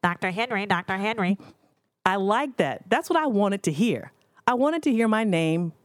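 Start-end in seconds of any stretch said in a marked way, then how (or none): 0.0-1.4 nasal paging voice with nose held; pinched-nose pager call. Pretend. Dreaming of being paged, nose pinched like a pager call. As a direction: squeeze the nose like a hospital pager. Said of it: "squeeze my nose and go".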